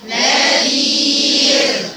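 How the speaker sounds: نذير held long and chanted; drawn-out nadhir. Shown as a demonstration. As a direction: stretch نذير slowly. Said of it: "ازاي تقولوا نذير".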